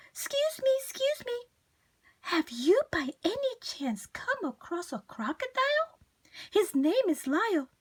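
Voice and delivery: fretful little plea for attention; timid high voice. Acted. Mrs Prim searching.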